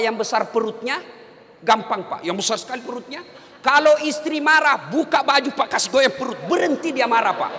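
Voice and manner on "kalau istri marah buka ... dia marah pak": loud, higher sermon delivery; preaching at full force. Real, not acted. Ustaz preaching to husbands about nagging wives, full force. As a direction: preach it out to the whole hall. Preacher's comic advice, shouted.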